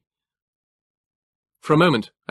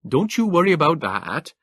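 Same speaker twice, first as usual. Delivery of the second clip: higher clipped northeast English trooper; northeast England accent; 7 semitones higher, 4 dB louder than usual. A narrator's reading. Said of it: "the clipped, Teutonic accent of the northeast of England".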